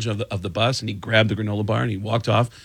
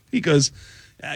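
ordinary talk, nothing marked

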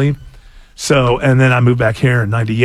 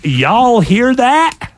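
drawn-out, raised Southern headline read; Southern accent; 8 semitones higher, much slower than usual. Acted. Host reads a headline about accents, slow put-on Southern. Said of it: "Southern accent ranked the most attractive".

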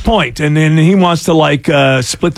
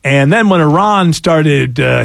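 ordinary talk, nothing marked

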